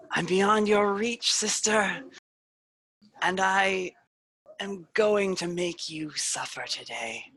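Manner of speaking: villainous reflection taunting her sister, slow and mocking; taunting spirit. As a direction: savor every word of the threat. Wrath taunting her sister Aiden.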